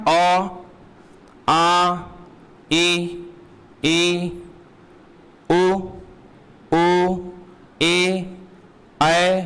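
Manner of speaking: each vowel said separately with long pauses; teacher's slow, measured vowel-by-vowel recital; reciting Hindi vowels. Shown as a demonstration. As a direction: sound out the vowels one at a time, अ, आ, ई. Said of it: "Hindi का vowel क्या होता है".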